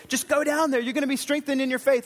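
God's call pitched high and eager; high urging voice of God. Acted. Preacher voicing God telling Gideon to go, high and urging. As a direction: lift the pitch well up, urging him on.

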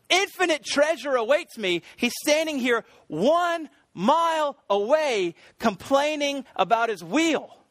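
high, slow, fervent preacher's delivery; emphatic preaching. Acted. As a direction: drive the point home slowly, word by word. Preaches the illustration's point.

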